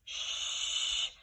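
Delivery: long hushing shh; shushing sound. Shown as a demonstration. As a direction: shush like asking for quiet. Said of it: "Put your finger over your mouth".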